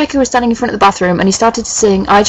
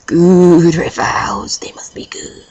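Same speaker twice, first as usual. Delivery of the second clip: slow, drawn-out whisper; much slower than usual. Acted. Reader begging listeners for good reviews, slow hushed whisper. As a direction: trail off into an eerie whisper.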